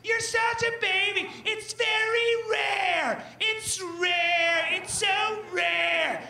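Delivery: high, drawn-out whiny taunt; mocking sister. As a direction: stretch it out high, a mocking sibling singsong. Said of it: "My sister's like".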